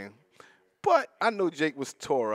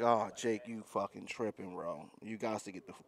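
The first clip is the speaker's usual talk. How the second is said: low aside; 7 semitones lower, 11 dB quieter than usual. Retold or imitated. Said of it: "I'm like".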